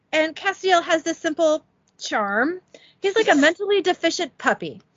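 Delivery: lightly voiced petulant Metatron. Metatron rant, higher far voice.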